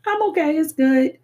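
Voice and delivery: high, breezy mock reassurance; hammed-up cheerful lie; fake okay. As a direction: sing out a fake everything-is-fine. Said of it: "My favorite line".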